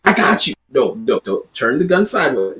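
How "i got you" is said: louder, higher hammed-up tough-guy line; a laughing mock threat from a bad shot; mock gangster. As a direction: play a wannabe shooter boasting, half joking. Mock shooter with sideways gun.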